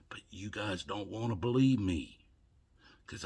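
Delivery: hushed bitter whisper. Real, not acted. Telling viewers they won't believe him, in a whisper. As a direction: drop to a whisper, almost to yourself.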